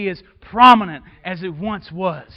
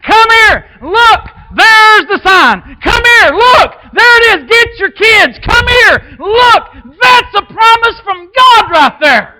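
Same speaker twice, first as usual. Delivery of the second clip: loud, high calling the family over; excited calling out; 12 semitones higher, 10 dB louder, slower than usual. Retold or imitated. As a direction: call everyone over to see it. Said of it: "it's meant for you to say".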